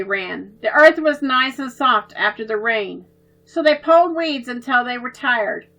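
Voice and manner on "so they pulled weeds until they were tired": ordinary delivery, nothing marked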